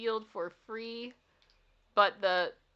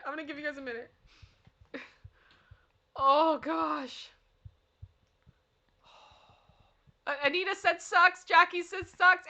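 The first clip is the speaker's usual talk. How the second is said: ordinary delivery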